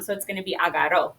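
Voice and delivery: agarró with a soft dental tap instead of a trill. Shown as a demonstration. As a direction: say agarró touching the teeth, no roll. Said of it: "make it a dental kind of a sound".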